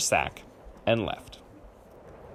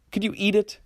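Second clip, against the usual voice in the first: put-on child's question; 11 semitones higher than usual. Curious grandchildren's questions.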